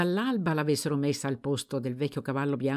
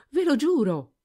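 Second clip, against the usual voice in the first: higher, louder desperate cry; louder hasty oath; 11 semitones higher, 6 dB louder than usual. A narrator's reading. Servant swearing to her master, louder desperate cry. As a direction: shout the oath again, desperate. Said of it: "La ragazza rispose precipitosamente".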